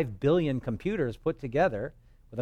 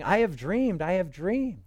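slow, higher mocking sing-song; mocking false prophets; 6 semitones higher, 3 dB louder, slower than usual. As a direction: mimic the lying prophets with scorn.

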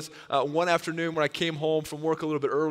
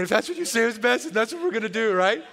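grudging, higher, exasperated agreement; huffing and puffing; 7 semitones higher, 4 dB louder than usual. Retold or imitated. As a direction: go along while still fuming. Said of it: "I was just huffing and puffing".

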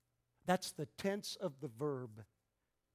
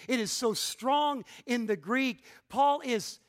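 raised, emphatic pulpit delivery; forceful preaching; 6 semitones higher, 8 dB louder than usual. Real, not acted. Preacher telling congregation what Paul taught, forceful preaching. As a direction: preach it higher and louder, punching key words.

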